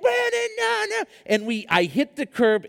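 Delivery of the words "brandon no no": high desperate no, no; panicked cry. Retold or imitated. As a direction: cry out as the crash comes. Panicked plea before the curb.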